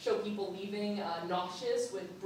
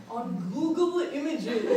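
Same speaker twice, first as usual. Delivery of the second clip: laughing through the words, slow; 5 semitones higher, 14 dB louder, slower than usual. Real, not acted. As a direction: giggle it out while saying it. Laughing at own joke.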